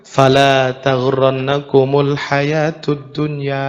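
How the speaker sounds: slow, low Quranic recitation; recited verse. Acted. Preacher quoting the Quran to the congregation, slow solemn recitation. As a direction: intone the verse reverently.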